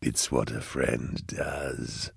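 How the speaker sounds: slow, low, soft grin in the voice; grinning demon. A narrator's reading. Cherubael calls himself Eisenhorn's friend, sly slow grin. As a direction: purr it softly and slowly. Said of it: "grinned Cherubael".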